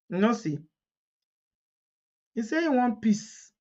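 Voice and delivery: ordinary talk, nothing marked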